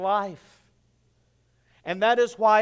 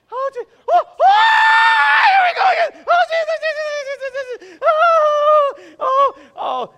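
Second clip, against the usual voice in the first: much higher, loud panicked screaming; screaming in terror; 10 dB louder than usual. Retold or imitated. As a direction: shriek through the second plunge. Imitating Ashley, second drop.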